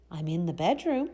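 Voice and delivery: ordinary delivery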